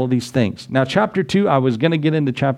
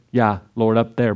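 ordinary delivery

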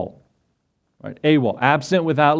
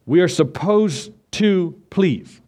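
"supposed" stretched out slowly; slow, deliberate weight on "supposed"; emphasis on SUPPOSED; 3 semitones higher, slower than usual. Shown as a demonstration. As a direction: lean hard on "supposed", slowing the whole phrase. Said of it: "emphasis on the word supposed".

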